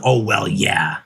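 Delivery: mimicking her shrug with a sneer; mocking echo. Son mockingly echoes mom's dismissive words back at her. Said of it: "what do you mean".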